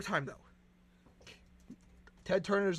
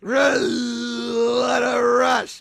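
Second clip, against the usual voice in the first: shouted catchphrase; 7 dB louder, much slower than usual. Acted. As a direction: yell it out, full of glee.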